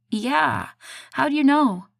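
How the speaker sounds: high, loud puzzled question; surprised. A narrator's reading. Asking her boyfriend how he knew, surprised and high. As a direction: catch her off guard.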